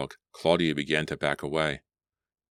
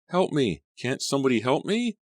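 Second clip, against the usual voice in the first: high, louder desperate call; desperate call for help; 10 semitones higher, 3 dB louder than usual. A narrator's reading. Claudia surfacing, high desperate call for help. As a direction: cry out while gasping.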